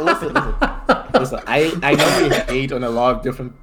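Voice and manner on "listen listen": laughing. Real, not acted. Laughing, drawn out plea.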